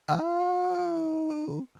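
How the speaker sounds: very high, drawn-out whiny "Oh"; whiny woman impression. Showing friend how she talks, whiny impression. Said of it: "like she does".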